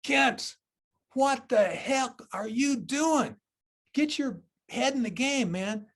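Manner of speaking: loud, high yelled rebuke; a father shouting across the gym at his son; dad's yell. Retold or imitated. Dad yelling at his son from the stands, loud and high. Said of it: "he said".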